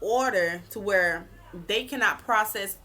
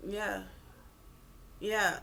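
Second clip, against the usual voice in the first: cold indifferent narcissist; 8 dB quieter than usual. Retold or imitated. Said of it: "cold, like, stiff face".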